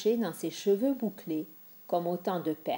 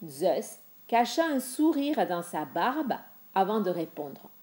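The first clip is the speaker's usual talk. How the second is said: plain reading voice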